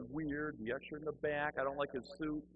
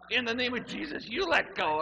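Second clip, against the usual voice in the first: higher, loud strained plea; mock choked retort; 9 semitones higher, 8 dB louder than usual. Man grabbed by the throat answers, high panicked command. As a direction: throw the exorcism back in comic panic.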